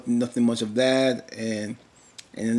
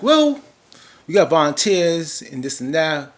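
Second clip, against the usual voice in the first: mock insistent Jacob; 4 semitones higher, 6 dB louder, slower than usual. Voicing Jacob pushing back.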